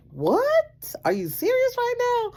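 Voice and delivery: shrieking high what; incredulous, loud protest; outraged disbelief. Retold or imitated. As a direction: cannot believe the husband. Reacting to husband's calm advice.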